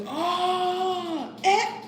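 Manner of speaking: high, drawn-out "oh" then a short "eh"; imitated frustrated noises. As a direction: mimic his wordless exasperation, very high. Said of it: "he made a few noises".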